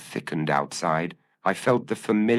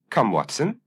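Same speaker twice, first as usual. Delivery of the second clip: brisk, higher call to action; 6 semitones higher than usual. A narrator's reading. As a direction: snap him into motion, briskly. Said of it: "he said briskly".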